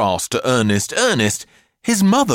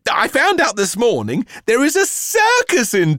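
high-pitched, thrilled reveal; eager mother, voice leaping up; excited mother; 12 semitones higher, 4 dB louder than usual. A narrator's reading. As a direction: announce the treat with mounting delight.

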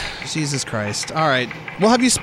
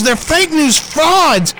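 louder, drawn out; 8 semitones higher, 5 dB louder, much slower than usual. Acted. Escalating brag.

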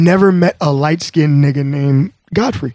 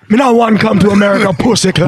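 quick Jamaican patois imitation; mock Jamaican accent; faster than usual. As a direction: put on a rowdy Jamaican accent. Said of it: "where did the accent come from?".

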